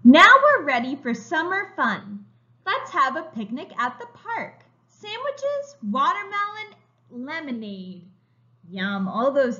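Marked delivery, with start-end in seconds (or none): 0.0-8.1 slow, bright storybook reading; reading to children. A narrator's reading. Teacher reading a picture book to young children, slow and bright. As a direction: read it like a picture book for little kids.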